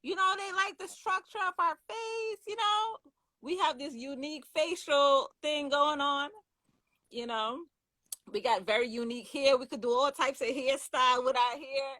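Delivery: excited. Real, not acted.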